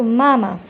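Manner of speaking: "मामा" with the "aa" pulled long; long vowel. Shown as a demonstration. Teacher shows how to stretch the vowel, long "aa". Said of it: "कैसे हम इसे खींचेंगे".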